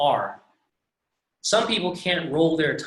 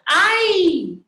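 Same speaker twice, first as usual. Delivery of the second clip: high, loud yelp of "Ay!" on falling out; Colombian student's cry; 9 dB louder than usual. Retold or imitated. Imitating a student who falls out of a handstand, loud high yelp. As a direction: squeal "Ay!" sharp and high. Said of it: "she'd go".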